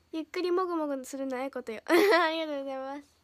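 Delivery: usual speaking voice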